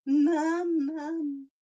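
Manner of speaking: high, loud whiny dog call; dog calling mum. Retold or imitated. Owner mimics her dog shouting for mum, high loud wail. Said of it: "Gunner's learnt that".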